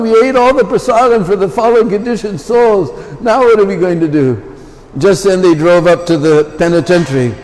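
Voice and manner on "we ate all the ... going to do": hammed-up panic over the empty pot; mock dismay. Danavir despairs.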